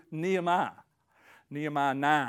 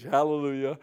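ordinary delivery

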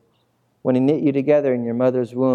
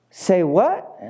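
mock disbelief, rising sharply; comic double take; baffled disciples; 4 semitones higher than usual. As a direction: swoop up into a stunned question. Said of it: "the disciples are like".

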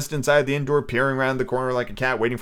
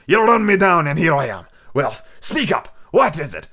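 loud, angry sea captain; gruff captain; 5 semitones higher, 5 dB louder than usual. Acted. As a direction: challenge him head on.

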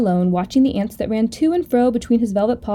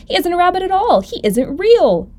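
high-pitched storybook exclamation, slowing at the end; high, emphatic accusation; exclaiming; 8 semitones higher, 4 dB louder than usual. Acted. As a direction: denounce him, drawing out the finish. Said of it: "he exclaimed".

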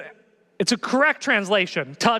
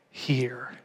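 breathy whispered "here"; 7 semitones lower, 7 dB quieter than usual. Retold or imitated. Whispered end of Peter plea.

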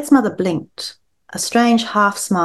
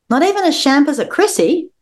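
disbelieving; 9 semitones higher than usual. Acted. Disbelieving mother in read story.

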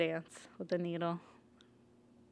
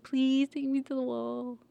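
higher, slow mock begging; mock pleading; 6 semitones higher than usual. Arya begs the captain for passage, mock pleading. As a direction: draw out the please like a kid begging. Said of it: "I was like".

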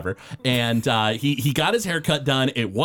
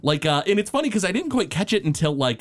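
ordinary talk, nothing marked

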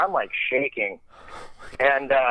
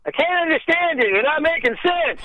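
Norm Macdonald voice; 13 semitones higher than usual. Retold or imitated. Norm voiced through door.

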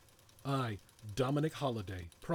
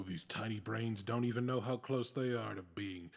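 normal talking voice

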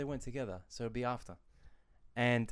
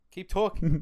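loud, raised-pitch order; insistent urging; 8 semitones higher, 11 dB louder, slower than usual. Real, not acted. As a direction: push them to keep going, much louder. Repeats it louder, pushing the co-host.

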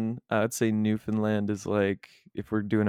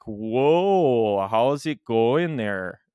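slow, drawn-out Ontario greeting; Ontario accent; 2 semitones higher, 4 dB louder, much slower than usual. Shown as a demonstration. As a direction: Ontario accent sliding into a little Minnesotan. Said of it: "Ontario is like".

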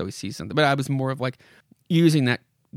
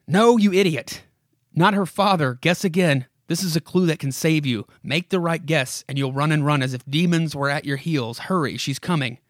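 louder, higher scolding narrator; urgent story narration; 3 semitones higher, 4 dB louder than usual. Acted. Narrator warns Lockwood that she's coming, urgent dramatic narration. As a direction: burst in, urging him to guess right.

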